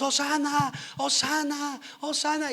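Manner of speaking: crowd acclaiming. Retold or imitated. Crowd welcoming Jesus into Jerusalem, ringing chanted praise. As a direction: call it out like a festive crowd.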